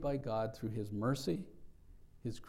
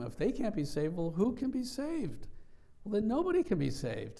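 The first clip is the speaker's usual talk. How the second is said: much higher, incredulous rising question; astonished disciples; 10 semitones higher than usual. Retold or imitated. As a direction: play their stunned reasoning aloud. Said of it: "they were astonished at what Jesus said".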